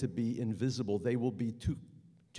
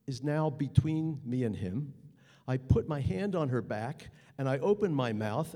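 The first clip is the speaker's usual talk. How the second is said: ordinary talk, nothing marked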